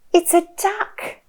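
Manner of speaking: animated, slow picture-book reading with big swoops; reading to a toddler. Shown as a demonstration. Mum sharing a picture book with her little one, animated child-directed reading. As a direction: draw out the words, playful and full of wonder.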